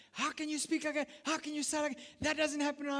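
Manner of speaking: high-pitched, indignant knee-jerk scolding; indignant parent. Acted. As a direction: react like an outraged parent, pitch jumping up. Acted parent snapping back at child.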